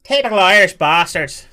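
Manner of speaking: put-on loud, high leprechaun Irish accent; terrible Irish accent. Streamer mocking the chat in a terrible Irish accent. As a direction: put on a bad, over-the-top Irish accent. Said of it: "Terrible, terrible accent".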